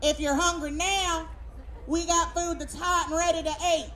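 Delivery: high, hollered Southern pitch; simple Southern voice. Retold or imitated. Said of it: "it had a simple Southern voice that said".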